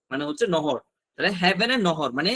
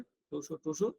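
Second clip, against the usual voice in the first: much softer, barely voiced to show; saying it in your head; 4 semitones lower, 11 dB quieter, slower than usual. Shown as a demonstration. Teacher shows students how to repeat silently, almost inaudible. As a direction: murmur it as if only to yourself. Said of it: "মনে মনে বলবেন".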